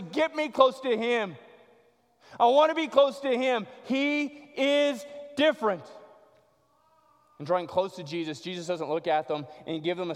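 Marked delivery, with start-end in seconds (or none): 0.0-5.8 high, loud, insistent plea; urgent plea. Acted. Lame person begging the people around to get close, urgent plea.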